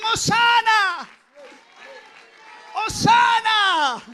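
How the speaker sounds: high, loud, drawn-out cry of praise; crowd's fervent acclamation; fervent shouting. Acted. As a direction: call it out fervently, stretching the word. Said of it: "Todos gritaban fervientemente esa expresión".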